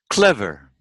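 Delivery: teacher's careful CLEVER, stress up front; first-syllable stress. Shown as a demonstration. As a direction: punch the CLEV of CLEVER. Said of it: "we stress the first syllable".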